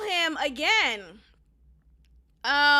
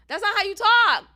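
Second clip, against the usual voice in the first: raised, louder comeback; emphatic rebuttal; 6 semitones higher, 8 dB louder than usual. Retold or imitated. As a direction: shut the excuse down, loud and higher. Acted rebuttal to his excuse.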